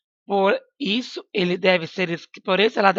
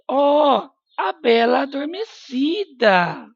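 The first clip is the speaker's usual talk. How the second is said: high, excited exclamation; exclaiming over the sleeping beauty; 6 semitones higher, 4 dB louder, slower than usual. Shown as a demonstration. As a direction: read it with the exclamation mark in your voice. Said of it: "Exclamação de novo".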